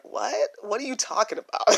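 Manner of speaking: high, quick baffled reply; puzzled retort. Retold or imitated. Young [name] answers her grandmother, baffled. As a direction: toss it back in disbelief. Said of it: "I would look at her like".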